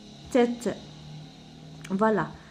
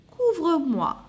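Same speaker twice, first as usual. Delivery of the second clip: put-on sick child pleading; 6 semitones higher than usual. Sick child calls to mother.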